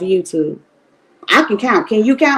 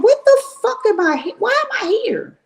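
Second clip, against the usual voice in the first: much higher, incredulous self-quote; exasperated disbelief; 12 semitones higher than usual. Retold or imitated. Host mocking herself for hate-watching, exasperated higher voice. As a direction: ask yourself why you're even there, half laughing. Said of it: "And I be like".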